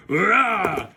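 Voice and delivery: short toy-monster roar; mock monster roar. Robot striking with its tail, mock roar.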